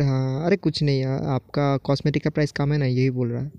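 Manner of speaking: ordinary talk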